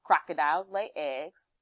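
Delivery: ordinary talk, nothing marked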